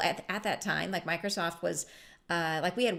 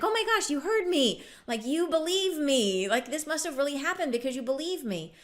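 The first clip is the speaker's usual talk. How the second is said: much higher, louder delighted caller; high gushing mock relief; relieved excitement; 7 semitones higher, 4 dB louder than usual. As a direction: gush like someone finally believed. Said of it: "when they're like".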